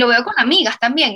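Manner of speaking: ordinary talk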